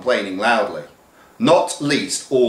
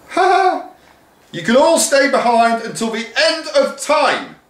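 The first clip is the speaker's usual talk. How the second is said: very high, loud cackling taunt; villainous teacher shouting; gloating shout; 4 dB louder than usual. Acted. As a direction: gloat from the doorway. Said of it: "shouted Miss Watkins from the doorway".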